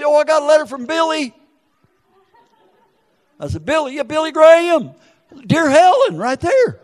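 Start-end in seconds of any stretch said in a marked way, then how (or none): 0.0-1.3 high, quick voice of a proud letter-getter; excited donor. Retold or imitated. Donor proudly announcing her letter from Billy, excited. 3.6-6.8 high, excited back-and-forth; mock amazement. Preacher imitating Helen thrilled over Billy Graham's letter, mock excitement.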